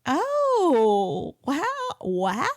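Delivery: a catch in the throat on wow; fighting back tears at the news; choked up. Retold or imitated. Narrator reliving hearing her ex is expecting a baby. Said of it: "And I was just like".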